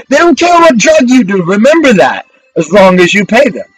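ordinary talk, nothing marked

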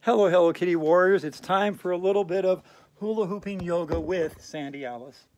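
put-on big loud welcome to the kids; excited greeting. Host greeting her young viewers, loud and excited. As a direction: call out the hello like opening a show.

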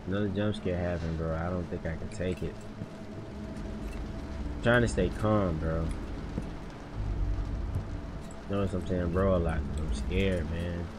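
low, subdued nervous murmur; scared, trying to stay calm. Real, not acted. Player admitting he is scared of another jump scare, subdued and nervous. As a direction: keep it small and low while scared. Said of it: "I am scared".

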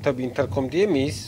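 usual speaking voice

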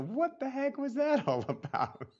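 high, amused disbelief; a laughing what-the-heck; mock bewildered. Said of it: "you laugh and you go".

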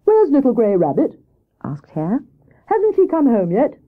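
a hint of an enquiring Hare; lightly voiced Hare. Hare asks Squirrel after missing Grey Rabbit, lightly voiced.

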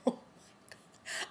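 breathless, whispered oh my God; a stunned whisper; shocked whisper. Real, not acted. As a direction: gasp the words out under the breath. Shocked reaction to the killing.

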